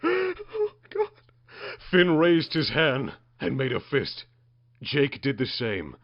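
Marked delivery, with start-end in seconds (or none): 0.0-1.1 mock cry.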